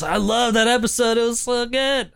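high, breathless fan gushing. Retold or imitated. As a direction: gush like a starstruck listener. Mocking a listener's praise.

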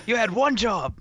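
high-pitched mock Cockney; bad Cockney accent. Mimicking an actor's line from a heist film, bad Cockney accent. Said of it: "With that bad Cockney accent".

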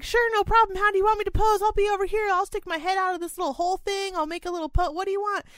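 put-on high, chirpy, helpful kid; eager little boy voice. Mother imitating her son's eager partner, high and chirpy. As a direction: play the overly willing classmate, bright and high. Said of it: "And he was like".

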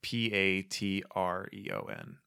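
P A T R E O N spelled slowly letter by letter; spelling it out. Real, not acted. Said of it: "How do you spell it?".

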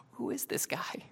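hushed, breath-filled amazement; acted stunned undertone; awed whisper. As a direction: whisper it in disbelief. Acts awestruck disciples.

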